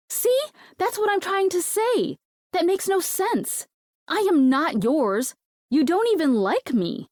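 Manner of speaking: high, loud, disbelieving protest; squeaky outraged objection; exasperated protest. Acted. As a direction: push back hard, half shouting. Lola protests Ben's claim.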